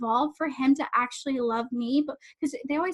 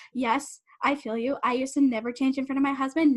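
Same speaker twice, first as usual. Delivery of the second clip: usual speaking voice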